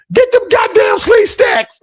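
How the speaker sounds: high-pitched, drawn-out mock scream; comic falsetto yell, slowed down; imitated scream. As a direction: squeal it out like the screaming brother. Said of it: "he screamed out".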